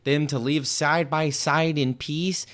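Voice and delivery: lightly hammed-up Michigan twang; mock Michigan accent. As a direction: mimic an evasive candidate in an overdone Michigan accent. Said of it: "what exactly is she saying of substance".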